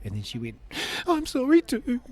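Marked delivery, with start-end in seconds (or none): 1.1-2.0 loud, very high tearful squeal; imitated crying. Ex-wife apologising back on the phone, imitated high wail. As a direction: wail it far above your own voice, as if weeping. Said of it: "we're crying to each other".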